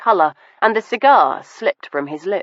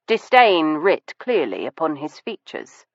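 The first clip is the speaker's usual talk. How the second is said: usual speaking voice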